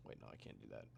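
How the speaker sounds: ordinary talk, nothing marked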